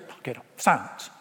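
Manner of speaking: low, soft stern hush; calm the storm with a quiet word; hushed knock it off; quiet command. Acted. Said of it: "he goes".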